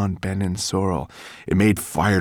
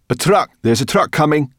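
high, loud cry of warning; urgent cry; 10 semitones higher, 8 dB louder than usual. A narrator's reading. Sorrel warns Ben of a coming truck, urgent cry. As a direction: call out the danger, high and loud. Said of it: "cried Sorrel".

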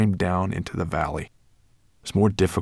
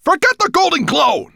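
high, loud snap; snapping dragon; 9 dB louder than usual. Acted. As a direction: cut him off sharply, loud and high. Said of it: "Nettlebrand snapped".

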